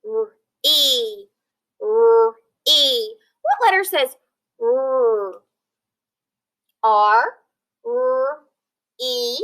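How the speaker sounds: R-E sounded out slowly letter by letter; sounding out letters. Shown as a demonstration. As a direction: sound out R-E like a teacher. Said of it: "Let's sound out that first syllable".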